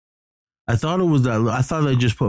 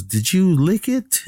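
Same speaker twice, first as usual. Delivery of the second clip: worried lady's question; 11 semitones higher, slower than usual. Retold or imitated. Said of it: "she goes".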